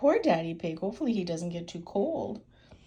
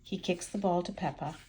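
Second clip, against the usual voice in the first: ordinary delivery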